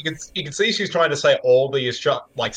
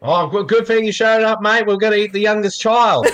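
high-pitched broad Australian family member; exaggerated Aussie accent; 7 semitones higher than usual. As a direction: play a caricature Aussie welcoming a stranger. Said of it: "doing our Aussie accent".

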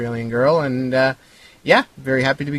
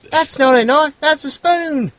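very high-pitched mock Australian accent; hammed-up Australian accent in a high put-on voice; bad Australian accent; 3 dB louder, slower than usual. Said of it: "you'll have to speak an Australian accent".

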